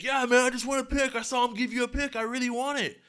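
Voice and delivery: put-on high, insistent drunk-fan impersonation; pestering drunk. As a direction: plead high like a pushy drunk.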